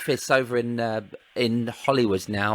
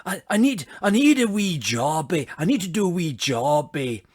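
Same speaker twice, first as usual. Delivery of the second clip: higher, louder Scottish warm-up line; Scottish accent; 4 semitones higher, 3 dB louder than usual. Shown as a demonstration. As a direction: get into a Billy Connolly Scottish voice. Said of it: "one thing I have to say to get into it".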